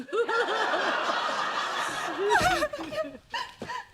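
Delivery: long, high-pitched laugh. Acted. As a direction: let out a drawn-out high laugh. Loud reaction after mocking complaint.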